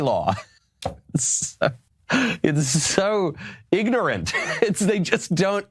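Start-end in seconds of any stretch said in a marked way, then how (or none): 1.1-5.6 high, slow words through real laughter; can barely get it out for laughing; real laughter, squeaking the words out. Real, not acted. Laughing at Danish law.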